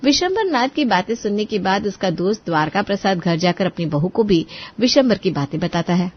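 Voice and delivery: ordinary delivery, nothing marked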